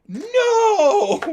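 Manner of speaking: long, loud, high-pitched fake "no"; mock shock. Pranksters feigning surprise at bad taste, mock-shocked wail. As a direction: wail it in pretend disbelief.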